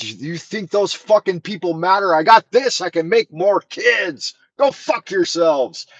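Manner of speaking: high, slow mock defiance; defiant princess quote. Retelling the princess's reply, mock high defiant voice. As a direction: fling it down from the balcony. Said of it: "And she said".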